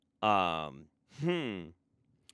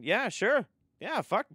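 sarcastic; 9 semitones higher, 7 dB louder, slower than usual. Real, not acted. Sarcastic reply to chat claim.

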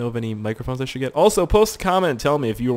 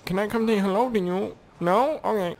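higher sing-song put-on voice; mock friendly greeting; 7 semitones higher than usual. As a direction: sweet-talk the enemy lightly.

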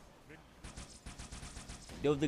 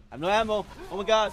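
loud, high-pitched cry of alarm; alarmed shout; 9 semitones higher, 9 dB louder than usual. Real, not acted. Runs out of ammo mid-fight.

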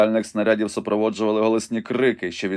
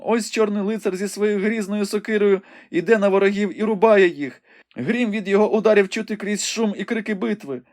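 high, urgent maiden reporting a battle; young woman Rebecca, breathless and excited; 11 semitones higher, 4 dB louder than usual. A narrator's reading. Rebecca calls the battle out to the wounded Ivanhoe, high and urgent. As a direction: describe the fight in a high, eager voice.

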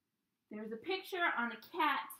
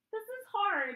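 strained; 10 semitones higher, 7 dB louder than usual. Real, not acted. Straining upside down in headstand.

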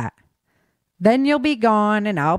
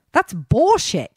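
higher, slow, biting dismissal; sharp snap; 6 semitones higher, slower than usual. A narrator's reading. Cassidy to Cole, rejecting his excuse: a slow, higher, sharp snap. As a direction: spit it out, hard and slow. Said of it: "Cassidy snapped".

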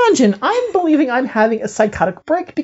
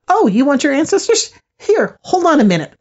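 lightly imitated eager helper, higher and quick; helpful spirit voice; 6 semitones higher, 4 dB louder, faster than usual. Spirit offering to fetch the ancestors, higher mock-helpful voice. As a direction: pop up brightly, pitched up. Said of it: "I hear a voice pop up, one of these females again".

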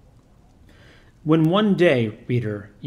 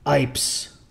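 dry. A narrator's reading.

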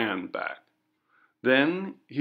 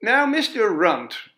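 higher, louder stern official voice; coroner; 10 semitones higher, 7 dB louder than usual. Acted. Coroner questions the villager, stern and official. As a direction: address the witness formally. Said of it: "said the coroner".

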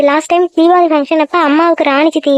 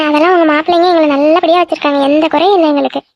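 usual speaking voice

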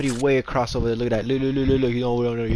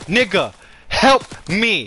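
pleading yell; 4 semitones higher, 5 dB louder, much slower than usual. Real, not acted. Begging teammate for help.

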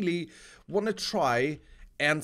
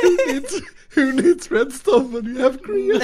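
cracking up mid-sentence; words squeezed out through laughter; laughing; 9 semitones higher, 7 dB louder than usual. Real, not acted. Laughing joke while ordering.

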